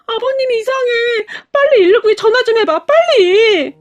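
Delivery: high, loud tearful pleading; crying panic. Acted. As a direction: sob out the call for help. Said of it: "펑펑 눈물을 흘렸는데요". (울면서 다급하게 외치는 목소리)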